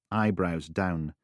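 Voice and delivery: usual speaking voice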